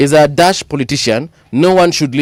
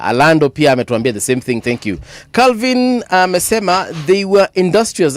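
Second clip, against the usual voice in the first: ordinary talk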